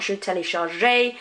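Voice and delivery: "téléchargeais" as a mistaken tay-lay-shar-ZHAY; wrong take, "téléchargeais" with a voiced last vowel; wrongly sounded final E. Shown as a demonstration. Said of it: "it's not".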